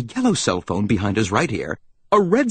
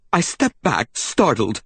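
usual speaking voice

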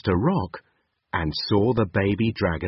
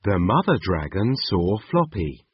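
usual speaking voice

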